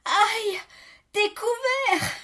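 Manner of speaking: high, loud cry of alarm; panicked yelp. A narrator's reading. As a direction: yelp as you are discovered. Victim found hiding under the bed.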